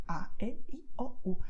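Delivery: A, E, I, O, U each as one clean Spanish sound; pure Spanish vowels. Shown as a demonstration. Teacher giving the sounds for five letters, pure Spanish vowels. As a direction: say A, E, I, O, U separately, without English glides. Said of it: "the corresponding sounds will be".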